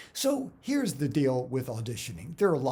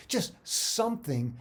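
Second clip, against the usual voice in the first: usual speaking voice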